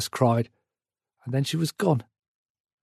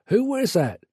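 woman calling from indoors, raised pitch; booming woman indoors; 6 semitones higher than usual. A narrator's reading. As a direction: call out the question in a high country voice. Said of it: "boomed a woman's voice".

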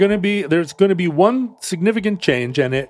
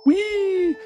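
high whooping "whee"; gleeful squeal. Real, not acted. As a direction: squeal with delight.

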